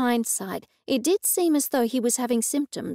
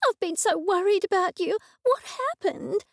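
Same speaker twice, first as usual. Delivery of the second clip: shrieking; 9 semitones higher than usual. A narrator's reading. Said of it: "she shrieked".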